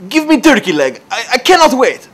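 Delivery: high, loud mock-Russian impatience; yelling Russian accent. Customer pressing the counter lady again, yelled Russian accent. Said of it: "this guy's, like, yelling at her".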